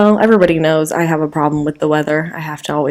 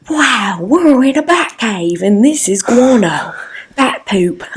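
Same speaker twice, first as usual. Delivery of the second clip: slow, awed Australian wildlife host; Steve Irwin; 4 semitones higher, slower than usual. Acted. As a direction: marvel in a hammed-up Australian accent. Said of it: "where he does go like".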